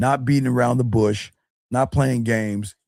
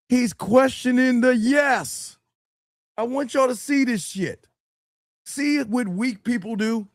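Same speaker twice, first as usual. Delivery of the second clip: mocking outrage; 12 semitones higher than usual. Real, not acted. Coach ridiculing a student's weak text to viewers, high mocking shout. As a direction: ridicule him to the whole room.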